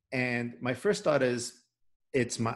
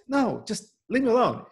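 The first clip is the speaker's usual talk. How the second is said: higher, mock irritated brush-off; retold teenage exasperation; annoyed brush-off; 11 semitones higher than usual. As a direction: wave her off, pitched up and a bit exasperated. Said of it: "And I'm like".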